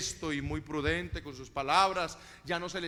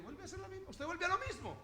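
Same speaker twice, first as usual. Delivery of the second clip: repeated scolding; 11 semitones higher, 8 dB quieter than usual. Retold or imitated.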